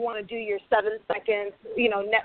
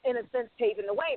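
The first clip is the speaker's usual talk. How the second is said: normal talking voice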